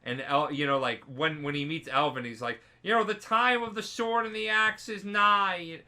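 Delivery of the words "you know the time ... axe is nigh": high, chanted proclamation; prophecy voice. Retold or imitated. Orphan reciting an end-times prophecy, intoned.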